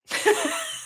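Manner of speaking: a quick high squeak of a laugh; real tickled laugh; high giggle. Real, not acted. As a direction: giggle at the scheme. Laughing after the quote.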